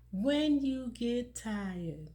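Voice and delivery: slow, deliberate, weighing each word; very slow emphatic repeat. Shown as a demonstration. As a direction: let the phrase sink in slowly. Repeats key phrase slowly for emphasis.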